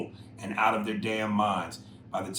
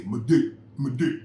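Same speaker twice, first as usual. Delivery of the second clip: louder mock caricature voice; 2 semitones higher, 7 dB louder than usual. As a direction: burst out as the meme's cartoon figure. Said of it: "and he'd say".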